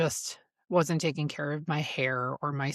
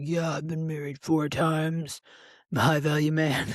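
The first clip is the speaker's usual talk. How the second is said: mocking a sleazy guy. Mocking the man she was set up with.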